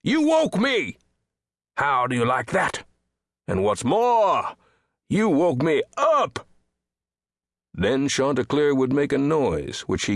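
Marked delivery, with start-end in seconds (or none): 0.0-6.5 outraged outburst, leaping up high on the key words; indignant scolding. Acted. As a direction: rage grandly at being woken. Rooster scolds whoever woke him.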